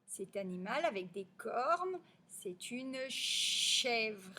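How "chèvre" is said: CHÈVRE with a long hissed ch; elongated initial ch. Shown as a demonstration. Teacher has pupils hear ch, "chèvre" stretched. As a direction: hold "ch" before "chèvre" opens. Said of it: "on entend le che".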